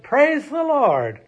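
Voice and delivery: slow, high, loud shout of praise. Acted. Old lady finding groceries on her porch, joyful shout. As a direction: belt it out in delight. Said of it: "and shouted".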